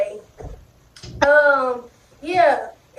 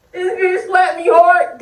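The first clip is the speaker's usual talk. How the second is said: ordinary delivery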